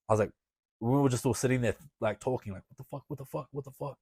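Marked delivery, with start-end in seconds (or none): 2.7-4.0 hushed, repeated disbelief; retold stunned muttering. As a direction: act out shocked murmuring under your breath. Retold stunned talk in the car.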